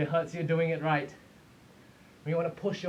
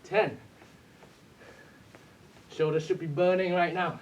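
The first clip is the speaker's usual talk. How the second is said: ordinary delivery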